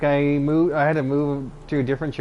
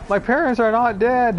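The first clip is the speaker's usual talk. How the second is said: higher-pitched imitation of a tearful protest; mock crying; 7 semitones higher, 3 dB louder than usual. Patient breaking down at visiting hours, imitated crying.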